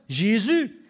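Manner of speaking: loud pleading call, slow on fils de David; crying out. A narrator's reading. Blind Bartimaeus begging Jesus, a loud plea. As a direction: call across the crowd for mercy. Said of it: "Et il cria".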